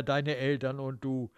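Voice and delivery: ordinary delivery, nothing marked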